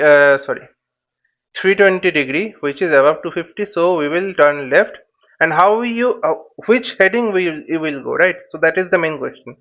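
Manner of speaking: ordinary delivery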